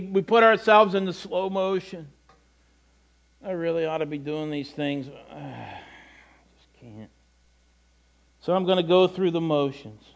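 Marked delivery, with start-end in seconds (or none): 3.4-7.1 low, quiet, defeated sigh of a voice; weary reluctance. Acted. Reluctant believer talking himself out of doing things, wearily. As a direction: sigh and give up, trailing to almost nothing.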